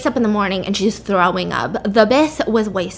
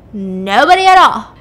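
loud, slow, emphatic no; over-insistent denial; 8 dB louder, slower than usual. Retold or imitated. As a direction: stress every word, overselling the lie. Lying to suspicious husband.

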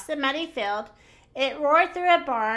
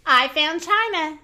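higher, louder storybook shout; 4 semitones higher, 6 dB louder than usual. A narrator's reading. Minnie announcing her find from the bus, excited shout. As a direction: call out the discovery. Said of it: "shouted Minnie".

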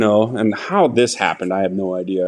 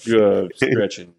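sheepish excuse; 3 semitones lower, slower than usual. Retold or imitated.

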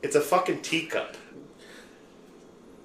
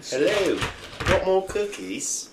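lightly put-on English accent; 6 semitones higher, slower than usual. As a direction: offer things in a mock English accent. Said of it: "Do you have an English accent?".

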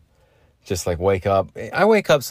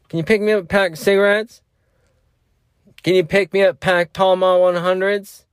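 high-pitched, louder mock begging; imitated junkie ex-girlfriend; 9 semitones higher, 4 dB louder than usual. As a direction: wheedle for cigarettes in a high voice. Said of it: "like the junkie ex-girlfriend".

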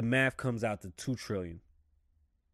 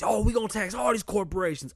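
higher, louder mock voice of the critics; 10 semitones higher, 6 dB louder than usual. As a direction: parrot the critics with a sneer, pitched up. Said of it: "the first thing y'all say is".

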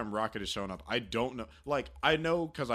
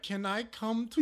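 slow, much higher pleading voice; imitating a timid plea; 11 semitones higher than usual. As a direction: ask it slowly, high and hopeful. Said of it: "with him being like".

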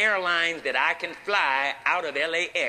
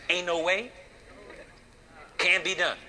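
ordinary talk

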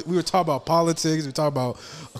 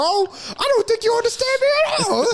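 mock whiny protest; 8 semitones higher than usual. S0 mocks friend's protest.

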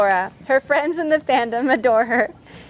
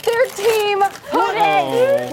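drawn-out amazed; 5 semitones higher, much slower than usual. Real, not acted. Reading surprise shirt text.

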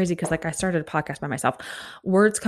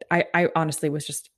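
normal talking voice

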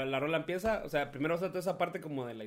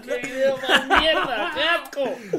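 slow, louder mock disgust through laughter; laughing scorn; 8 semitones higher, 6 dB louder, slower than usual. Real, not acted. Se burla riéndose del video. (asco burlón entre risas)

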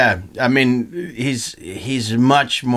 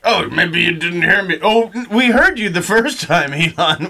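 higher, mock-polite room of investors; 6 semitones higher than usual. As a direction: play the stunned investors answering politely. Mock investors voiced to Elon.

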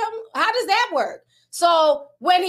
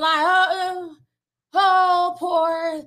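drawn-out, higher mock pity; mock crying sympathy; 4 semitones higher, much slower than usual. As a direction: imitate online fans fussing over him. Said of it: "there was some people crying online".

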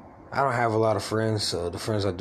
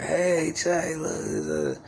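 high mock call-out, dropping at the end; imitating excited family; 8 semitones higher, much slower than usual. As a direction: imitate relatives calling out at the TV. Family calls out when commercial airs.

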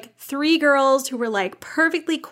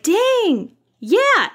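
high-pitched impressed reaction; 11 semitones higher, 4 dB louder than usual. Retold or imitated. Reader reacting to a book that hit home, impressed. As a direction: retell being struck by it, pitched right up.

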